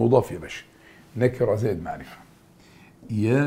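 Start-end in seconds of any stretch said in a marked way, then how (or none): none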